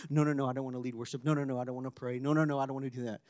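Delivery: hushed, quick, nervous no, no, no; fearful refusals. Retold or imitated. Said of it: "conditioned to respond in fear".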